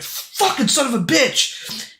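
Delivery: high, drawn-out, strained curse; frustrated cursing. Retold or imitated. Gamer retelling how he threw the controller, strained frustrated curse. Rages after failing boss fight.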